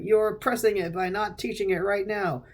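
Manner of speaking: high, accusing crank; mock outrage. Crank accusing a doubter of suppressing him, mock outrage. Ray's side of the acted argument.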